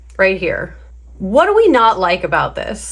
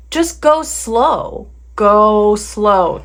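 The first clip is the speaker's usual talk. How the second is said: drawn-out slow; much slower than usual. Shown as a demonstration. Teacher urging hasty beginners to slow down, drawn-out. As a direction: stretch each word right out. Coach models going slow.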